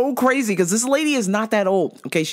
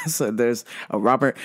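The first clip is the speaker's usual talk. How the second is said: ordinary talk, nothing marked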